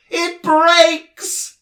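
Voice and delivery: very high, loud blissful cry; joyful gasp. A narrator's reading. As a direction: gasp of pure delight. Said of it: "he gasped joyfully".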